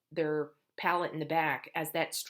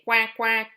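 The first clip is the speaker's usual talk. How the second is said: loud duck quack; 5 semitones higher, 10 dB louder than usual. Shown as a demonstration. Therapist doing duck sounds for a child, loud. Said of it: "Ducks say".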